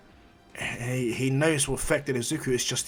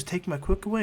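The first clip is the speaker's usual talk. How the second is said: high, unsure little Eri voice; raised, wondering voice; young Eri; 6 semitones higher than usual. Acted. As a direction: lift the pitch into a timid question. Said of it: "she goes".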